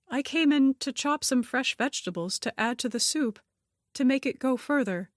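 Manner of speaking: put-on high-pitched, earnest young helper voice; flustered kitchen helper. A narrator's reading. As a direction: explain yourself innocently, voice pitched well up. Janet explaining herself to Harriet.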